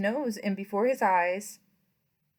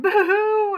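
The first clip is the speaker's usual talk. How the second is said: high, loud hammed-up wail "Boo-hoo!"; mock crying; 10 semitones higher, 9 dB louder than usual. The startled rat cries out, mock wail. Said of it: "who cried".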